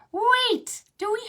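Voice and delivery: high, loud mock-surprised wait. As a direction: stop short in mock shock. Puppet surprised about horns.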